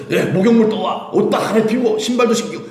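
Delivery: high, laughing eager orders; father ordering servants. Acted. Father tells servants to dress his son, laughing and eager. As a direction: bustle the servants along with joy. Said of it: "막 그러면서요".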